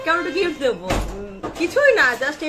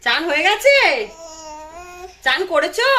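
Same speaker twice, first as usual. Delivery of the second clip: put-on mother repeating her question to the baby, louder; louder coaxing repeat; 3 semitones higher, 4 dB louder than usual. Shown as a demonstration. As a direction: call again to the child, louder with a lift on গেছে. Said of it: "repeated louder to child".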